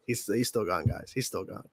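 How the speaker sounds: soft, low, stunned murmur; hushed aside. Real, not acted. Host telling viewers the co-host is gone, hushed. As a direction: drop right down, nearly under the breath.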